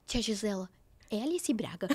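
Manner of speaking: low, soft, stunned aside; murmured starstruck question; hushed question. Retold or imitated. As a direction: ask discreetly, barely believing it. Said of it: "daí eu falei assim".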